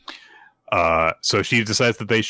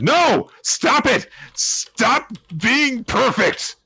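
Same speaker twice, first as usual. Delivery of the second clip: very high, very slow whine; hammed-up high whine; whiny wife voice; 3 dB louder, much slower than usual. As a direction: stretch it out like an exasperated wife. Yor exasperated at perfect husband.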